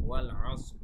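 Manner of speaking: demonstrating ওয়াল আসর for students to copy; model pronunciation. Shown as a demonstration. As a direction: say ওয়াল আসর clearly with two jazm stops. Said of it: "আমাদের এইভাবে উচ্চারণটা করতে হবে".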